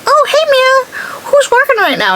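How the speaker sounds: high, slow, sugary mock greeting; mock sweet coworker. Mocking the coworker's sweet text, high and drawn out. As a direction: ham up the fake-friendly coworker.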